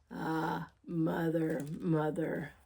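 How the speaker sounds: lightly voiced wolf, low and fond; growled wolf. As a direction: purr it low into her ear. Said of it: "the stranger growled in one ear".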